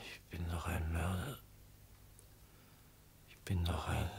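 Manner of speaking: drowsy murmur dying to a breath; fading into sleep. Acted. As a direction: let each phrase sink away as sleep takes him.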